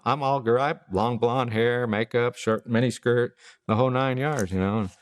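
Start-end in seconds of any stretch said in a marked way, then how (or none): none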